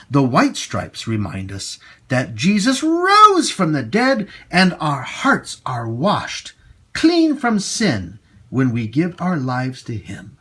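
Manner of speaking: ordinary delivery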